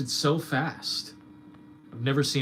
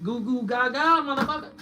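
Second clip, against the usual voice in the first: put-on high baby babble; mock baby talk; 13 semitones higher, 4 dB louder than usual. Streamer mocking critics with high baby talk. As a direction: coo like a baby to mock them.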